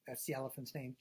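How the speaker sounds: ordinary delivery, nothing marked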